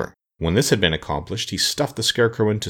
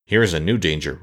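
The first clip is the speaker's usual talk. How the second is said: plain reading voice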